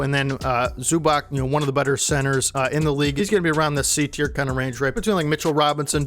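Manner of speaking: ordinary talk, nothing marked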